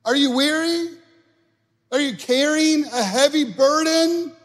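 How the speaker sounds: high, loud, stretched-out call; soaring preacher's shout, words held long; preaching Jesus' invitation. Acted. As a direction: call out to the weary at full voice. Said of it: "Jesus says this".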